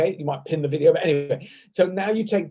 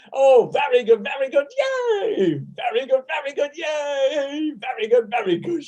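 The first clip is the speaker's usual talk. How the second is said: much higher, bright praise; cheering; 8 semitones higher than usual. Real, not acted. Teacher cheers the Zoom class after the exercise, excited praise.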